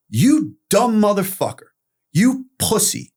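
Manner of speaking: high-pitched, punchy jeer; mock the crew's contempt, drawn out at the end; retold scornful insult. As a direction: throw it like a bully's taunt. Crew insult him in retold quarrel.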